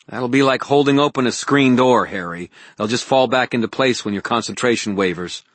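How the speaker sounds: insistent woman's objection; Elaine objecting insistently. Acted.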